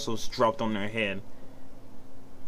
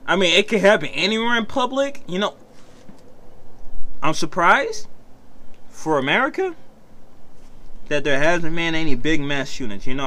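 ordinary talk, nothing marked

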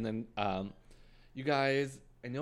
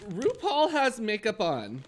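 high, loud and slow mocking delivery; mock bad punchline; 10 semitones higher, 6 dB louder, slower than usual. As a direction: drag out the lame joke, pitched up.

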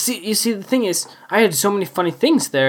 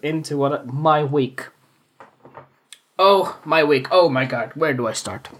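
usual speaking voice

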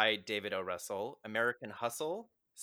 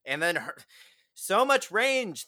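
excited gush; 9 semitones higher, 9 dB louder than usual. Real, not acted. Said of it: "gushing about the actress's range".

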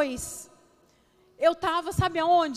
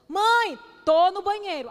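higher, louder call through a door; mock calling out; 4 semitones higher, 7 dB louder, slower than usual. Mother answering kids from the bathroom, mock calling out. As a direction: call back to the kids, playfully exasperated.

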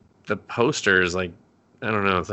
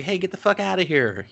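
lightly voiced, higher annoyed girl; mock annoyed DJ; 8 semitones higher than usual. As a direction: brush the intruder off. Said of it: "The girl who was D Jing?".

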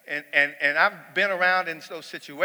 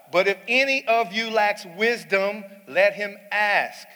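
higher, louder, slow proclamation; preaching at full force; 7 semitones higher, 8 dB louder, slower than usual. Acted. Preacher proclaims the verse to the church, slow and loud. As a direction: draw out every word to the crowd.

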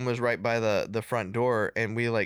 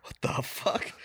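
whispered; 4 dB quieter than usual. Retold or imitated. Dad reacting to thud.

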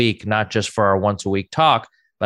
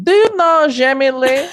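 very high, louder eager question; excited mom; 6 dB louder than usual. Retold or imitated. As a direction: ask strangers all thrilled. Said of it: "And she'd be like".

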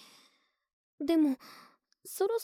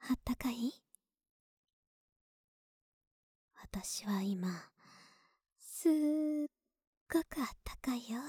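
intimate whisper; 5 dB quieter than usual. Acted. Girlfriend whispering while hugging her partner under the covers. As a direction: murmur it right into their ear.

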